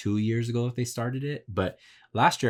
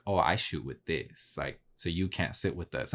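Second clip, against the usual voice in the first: mock-snooty photographer brushing off a newcomer, softer; snobby mock voice; 3 semitones lower, 5 dB quieter than usual. Photographer mocking elitist peers, softer snobby mock voice. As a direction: sniff it out like a gatekeeping snob. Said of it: "It's not about being like".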